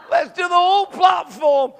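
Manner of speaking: rally him to go round again; excited call. Retold or imitated. Preacher urging the healed man back along the platform, thrilled and loud.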